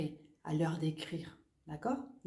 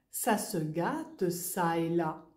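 slow example; 2 semitones lower, slower than usual. Shown as a demonstration.

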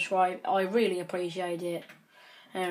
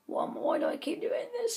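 exasperated outburst; 13 semitones higher, 3 dB quieter than usual. Real, not acted. Keeps breaking blocks by mistake.

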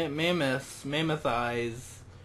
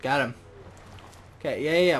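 normal talking voice

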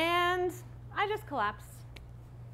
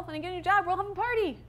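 put-on bright, higher, cheery promise to the kids; upbeat reassurance; 7 semitones higher, much faster than usual. Mother promising her kids a party, upbeat. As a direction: sell the silver lining with a lift. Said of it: "silver lining ... positivity".

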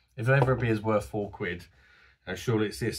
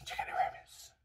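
hoarse whisper as the voice fails; croaky, failing voice; voice going; 11 dB quieter, faster than usual. Real, not acted. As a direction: voice giving out, almost no sound. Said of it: "my, uh, voice is going a bit".

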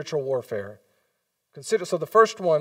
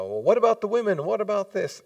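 mock objection; 6 semitones higher, 3 dB louder than usual. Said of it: "where it's like".

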